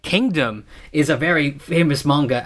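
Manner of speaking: ordinary talk, nothing marked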